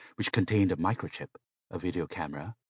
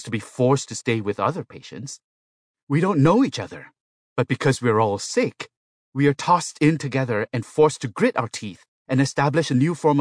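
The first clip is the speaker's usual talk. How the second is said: loud, raised-pitch indignant protest; 6 semitones higher, 6 dB louder than usual. A narrator's reading. Patient protests to a medical robot about the ward, indignant. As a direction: object loudly, pleading the case. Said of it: "Yang Wei protested".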